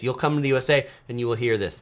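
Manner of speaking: usual speaking voice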